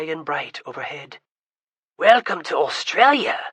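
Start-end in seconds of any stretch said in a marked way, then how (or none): 2.0-3.4 a boy's hammed-up Australian accent, louder. Seth welcomes everyone in his best Australian accent. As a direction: Seth shows off a mock Aussie greeting. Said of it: "Seth announced in his best local accent".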